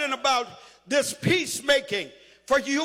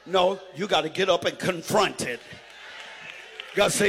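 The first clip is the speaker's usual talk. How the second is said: ordinary talk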